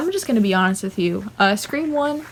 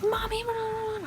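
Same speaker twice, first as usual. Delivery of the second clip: tiny, very high hushed voice; imitated whispering; 14 semitones higher, 10 dB quieter than usual. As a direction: squeak it softly, as if into an ear. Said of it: "like, whispering".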